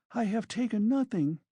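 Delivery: high-pitched, voiced fearful denial; frightened young woman, understated; mild terror. A narrator's reading. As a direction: plead innocence with a touch of fear. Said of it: "Sonia whispered in terror".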